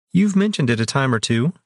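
a bored wry reply; dry remark. A narrator's reading. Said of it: "Soria commented dryly".

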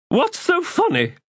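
demanding. A narrator's reading. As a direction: insist on an answer, bewildered. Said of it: "Precious Thimble demanded".

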